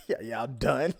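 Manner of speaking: laughing. Acted. Voicing baby, laughing.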